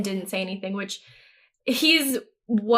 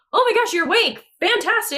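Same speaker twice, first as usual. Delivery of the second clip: high, gushing relief, lightly acted; relieved delight; 10 semitones higher, faster than usual. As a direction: imitate her overjoyed he's awake. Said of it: "She's relieved 'cause she's like".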